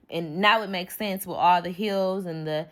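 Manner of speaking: normal talking voice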